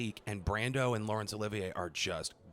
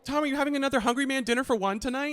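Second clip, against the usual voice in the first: much higher, fast taunting reporter; mock pestering; 13 semitones higher, 4 dB louder, faster than usual. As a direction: tease him cheerfully and quickly. Mocking paparazzi bit.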